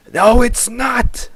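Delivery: high, slow, loud raspy denial; Zane's deranged raspy shout. Acted. Zane contradicting Dunny's reassurance, deranged raspy shout. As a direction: shout it back, raspy and drawn out. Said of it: "Just do a raspy voice".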